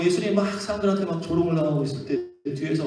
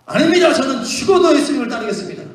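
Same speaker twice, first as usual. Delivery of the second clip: loud, higher protest of loyalty; eager vow; 5 semitones higher, 4 dB louder than usual. Acted. Disciples protesting to Jesus, loud and eager. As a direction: burst out promising to follow to death.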